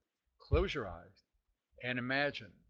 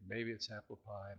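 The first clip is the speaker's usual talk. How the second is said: ordinary talk, nothing marked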